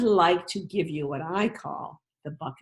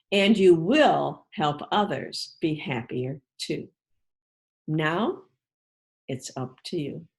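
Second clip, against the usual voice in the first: put-on reading to children; 4 dB louder than usual. Teacher reading the bucket book to her class, storybook voice. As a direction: read it to a class of little kids.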